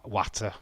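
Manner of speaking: "water" with a short flat a, watt-uh; Yorkshire pronunciation. Shown as a demonstration. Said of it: "Not water".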